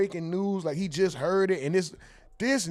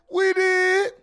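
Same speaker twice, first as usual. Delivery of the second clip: sudden much higher shout of triumph; celebratory yell; 14 semitones higher, 4 dB louder than usual. Pretend. Streamer celebrating with chat by shouting the chant high. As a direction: belt it out like a victory chant.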